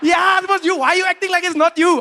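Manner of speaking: loud, very high protest; high-pitched, indignant South African fan; insistent street fan. Acted. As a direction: confront the star with excited certainty.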